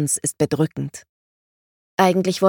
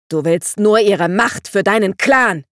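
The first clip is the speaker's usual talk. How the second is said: louder, raised accusation; shouting; 3 semitones higher, 6 dB louder than usual. A narrator's reading. Dandelion shouts at Vangelis mid-attack, loud. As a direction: yell it as you lunge. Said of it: "schrie Dandelion".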